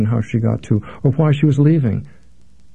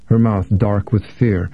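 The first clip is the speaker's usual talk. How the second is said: plain reading voice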